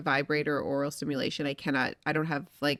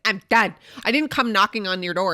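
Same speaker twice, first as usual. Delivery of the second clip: loud, higher exasperated I'm done; mock exasperation, much louder; fed up; 3 semitones higher, 6 dB louder than usual. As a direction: throw in the towel loudly. Gave up on the toy.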